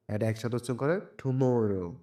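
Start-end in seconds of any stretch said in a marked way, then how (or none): 1.2-2.0 tomorrow with weight on the middle মর; stress on মর. Shown as a demonstration. Teacher putting the word together, মর stressed in tomorrow. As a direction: lean into মর in tomorrow. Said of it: "এগুলো জোর দিয়ে পড়তে হবে".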